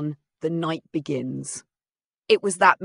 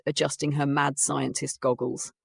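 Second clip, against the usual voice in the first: ordinary delivery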